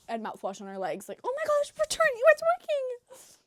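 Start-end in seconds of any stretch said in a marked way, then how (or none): 1.2-3.4 put-on childish excitement. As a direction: gasp like a kid watching magic happen. Kids thrilled by fake potion.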